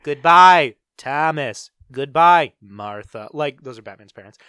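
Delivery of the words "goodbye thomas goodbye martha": loud farewell word, each name dropping away; mock alien farewell with punched goodbyes. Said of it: "what if it's like E T, where he says your name".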